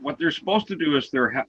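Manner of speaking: ordinary talk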